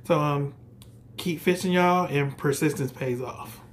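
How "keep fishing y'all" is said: normal talking voice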